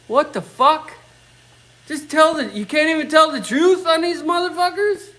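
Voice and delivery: real indignant rant building up; can't believe it, raising the voice; exasperated outrage. Real, not acted. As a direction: pitch climbs high in disbelief. Ranting about getting banned.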